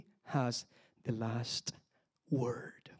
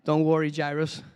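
louder reassuring call of "Jairus"; corrected, louder; 6 dB louder than usual. Retold or imitated. Preacher correcting himself, voicing Jesus, louder and firm. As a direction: call "Don't worry, Jairus" out firmly and louder.

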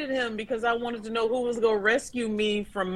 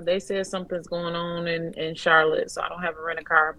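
usual speaking voice

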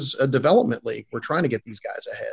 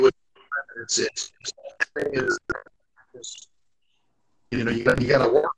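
through a tube. Real, not acted. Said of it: "it literally sounded like he was trying to talk through a tube".

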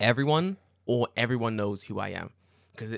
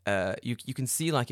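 ordinary delivery

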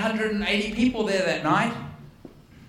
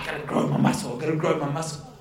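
mocking strained striver; 6 semitones lower, faster than usual. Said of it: "it's like".

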